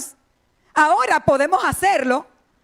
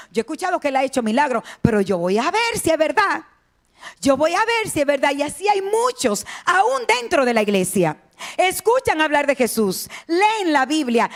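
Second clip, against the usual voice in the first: usual speaking voice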